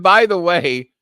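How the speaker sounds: eager repeat of by the way; excited retelling. Retold or imitated. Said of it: "I said".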